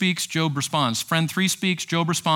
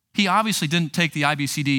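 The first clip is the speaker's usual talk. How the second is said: ordinary talk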